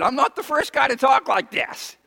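usual speaking voice

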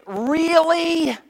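high, loud disbelief; preacher's indignant disbelief at the congregation; incredulous. Retold or imitated. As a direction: ask as if you can't believe your ears. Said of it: "you think".

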